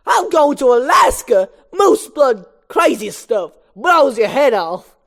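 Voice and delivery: high, loud, drawn-out comedian hype; Chris Rock. Acted. Moose Blood raves about Alaska, high comedian voice. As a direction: brag like a stand-up comic, stretching the words. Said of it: "I'm gonna do the Chris Rock voice".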